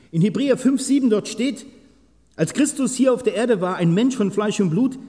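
high, loud preaching of the scripture; preaching at full force. Acted. Preacher to his congregation, reading Hebrews in a high loud preaching voice. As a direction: proclaim it to the whole church.

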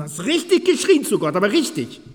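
high, loud preaching burst; preaching at full force. Acted. Preacher urging listeners to cry to God, high loud preaching. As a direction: push it out with fervour.

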